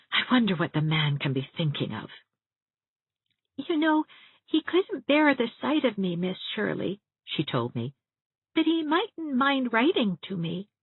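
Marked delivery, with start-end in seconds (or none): 3.6-7.0 put-on high, small child's voice, wistful; a lonely little girl confiding; little girl's voice. Elizabeth telling Miss Shirley her father can't bear her, small and wistful. 8.5-10.7 put-on high, small child's voice, wistful; a lonely little girl confiding; little girl's voice. Elizabeth telling Miss Shirley her father can't bear her, small and wistful.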